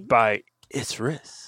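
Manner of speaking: slow, whispered ad-lib; hyped-up name drop. Pretend. Host slicing up the show intro, whispered hype. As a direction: whisper it slowly like a hype tag. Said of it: "You really hyped that up".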